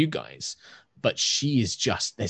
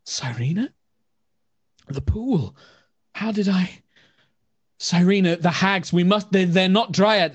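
high, rising alarm as she wakes; dazed, panicked woman; 9 semitones higher, 5 dB louder than usual. Acted. Disoriented woman voiced by the GM, high and alarmed. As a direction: blurt it out high and frightened, coming to. Confused woman waking in pool.

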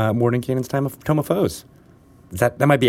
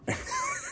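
sudden high snort of laughter; snorting laughs; 14 dB quieter than usual. Real, not acted. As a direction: snort into the mic mid-laugh. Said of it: "Did I just snort on the microphone?".

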